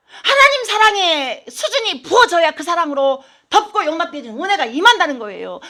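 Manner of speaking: ordinary delivery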